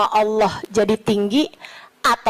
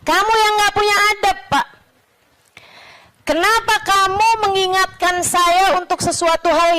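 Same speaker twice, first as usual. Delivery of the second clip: high, fast indignant comeback; sharp retort; 8 semitones higher than usual. Retold or imitated. The sheikh retorting to the man who scolded him, indignant. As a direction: throw the accusation right back.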